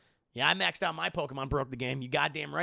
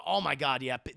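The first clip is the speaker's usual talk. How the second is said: ordinary delivery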